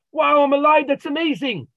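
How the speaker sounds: high, loud burst of joy; excited. Retold or imitated. Man telling the Rav he'd celebrate, loud joyful outburst. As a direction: shout it like you just escaped death. Man imagines surviving the crash.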